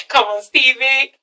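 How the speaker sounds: slow, fond mock scolding; charmed indulgence. Charmed by Stevie J's ways, slow fond scold. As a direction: let him off the hook, slowly. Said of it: "you're like".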